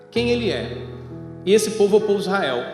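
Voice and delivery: ordinary delivery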